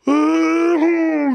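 long, high-pitched imitated wail; high keening cry, hammed up; mock crying wail. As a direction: let out one loud, drawn-out fake sob. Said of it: "starts crying".

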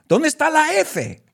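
high-pitched protesting question; exaggerated high indignant demand; mock outrage. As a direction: squeal it in mock disbelief. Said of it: "And I'm like".

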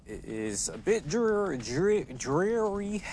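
"drear-, dreary" said slowly with a weak R, near "dweary"; "dreary" stumbled over, R softened toward W; says w for r. Real, not acted. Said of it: "How do you say that word?".

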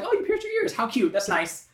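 high, loud mock-mother voice; unfazed mother. Podcaster mocking the mother's reaction to pierced ears, high-pitched unfazed mother. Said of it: "her mother's just like".

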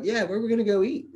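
high, eager mock-innocent question; teasing the recruiter for free food; eager reply. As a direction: pitch it high like a hungry kid asking.

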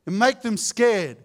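ordinary talk

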